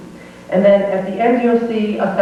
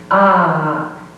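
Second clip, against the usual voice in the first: ordinary talk, nothing marked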